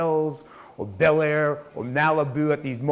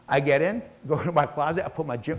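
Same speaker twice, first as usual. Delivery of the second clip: normal talking voice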